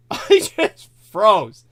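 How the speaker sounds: slow, laughing through the words. Real, not acted. Retelling freezing in fright to a friend, laughing. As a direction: chuckle it out slowly.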